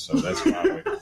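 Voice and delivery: breathless laugh, words barely voiced. Real, not acted. Host explaining the missing guest, breaking up laughing. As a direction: dissolve into laughter mid-line.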